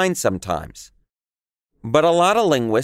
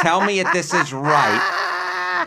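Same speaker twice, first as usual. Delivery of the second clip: laughing; 6 semitones higher, slower than usual. Real, not acted. Reacting to surprising quiz result.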